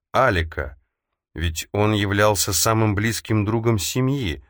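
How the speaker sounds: ordinary delivery, nothing marked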